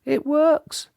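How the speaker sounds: high-pitched, amazed; wonderment. A narrator's reading. Mister Jelly finding that counting to ten works, amazed. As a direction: marvel in a squeaky voice. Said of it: "out loud in wonderment".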